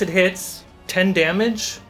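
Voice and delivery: ordinary talk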